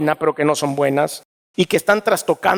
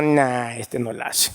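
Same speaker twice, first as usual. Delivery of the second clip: dismissive; 5 semitones lower, 5 dB quieter, slower than usual. Retold or imitated. Bored churchgoer dismisses preacher.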